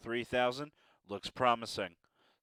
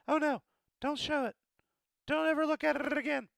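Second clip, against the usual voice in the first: much higher, fast panicked plea; mock panic; 3 dB louder, faster than usual. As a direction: beg them not to look, hammed-up. Embarrassed at a mistake.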